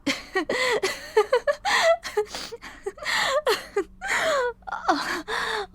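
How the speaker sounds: high teary laugh of relief, half crying; tearful laugh. Acted. Laughing through tears of relief after being accepted for Christmas. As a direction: laugh and cry at once with relief.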